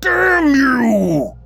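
high, loud monster scream; screaming demon curse; shadow screaming. Acted. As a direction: roar it out with fury. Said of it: "screams the shadow".

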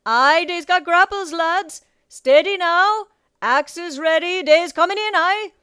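high, loud shipboard shout; rallying sea captain calling to the crew; Captain Nightlighter. A narrator's reading. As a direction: bellow drawn-out orders across a deck. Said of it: "Captain Nightlighter's voice from the poop deck".

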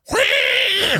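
very high, piercing mock squeal; imitated pig squeal. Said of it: "all of a sudden we hear".

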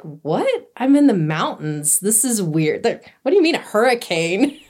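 baffled retelling, pitch leaping up on questions; incredulous. Retold or imitated. Hiker hearing of a hurricane in the mountains, incredulous. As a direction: question it in disbelief, voice swooping up.

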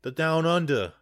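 put-on muddled London-meets-Aussie accent; mock British-Australian accent. Host placing a listener from London, mock British-Australian accent. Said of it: "that's your, that's your accent".

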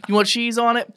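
high-pitched eager employee impression; hyping up. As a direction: sell it like a hyped-up server. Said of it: "hypes up your meal".

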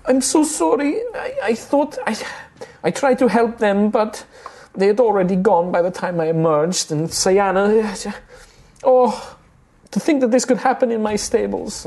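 high, tearful halfling woman apologising through tears; tearful apology. Acted. Stable owner Rosalina apologising tearfully to the party, high and upset. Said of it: "wiping the tears away".

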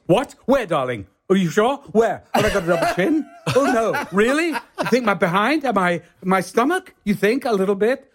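high, fussy alarm over his looks; vain panicking actor. Retold or imitated. Alan Bates asked about weight, high vain panic. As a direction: flutter in high panic, darling.

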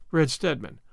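plain reading voice

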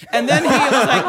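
loud laughing. Real, not acted. Comic still laughing while retelling. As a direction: laugh through it.

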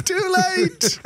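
very high, slow sing-song. Pretend. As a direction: draw it out high and teasing. Sing-song quip repeated.

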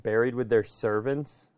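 normal talking voice

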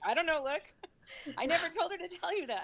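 high, quick flustered denial; flustered, caught-out denial; mock innocent plea. Doula's silent protest to doctor.

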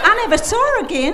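high, loud Kentucky twang; bad Kentucky accent. Cabin owner closes her lion story, high Kentucky accent. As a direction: cap the tale in an amateur Kentucky accent. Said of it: "my very bad Kentucky accent".

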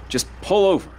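Dale insists Earl stop the truck now, urgently; urgent insistence. Acted. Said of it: "Dale let go of her hand".

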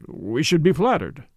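ordinary delivery, nothing marked

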